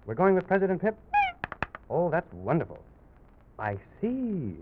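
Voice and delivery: ordinary delivery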